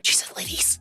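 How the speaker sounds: stunned whispered aside. Retold or imitated. Recalling being gendered correctly, whispered to self. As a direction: whisper it in disbelief. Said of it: "And I was just like".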